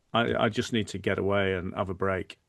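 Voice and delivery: normal talking voice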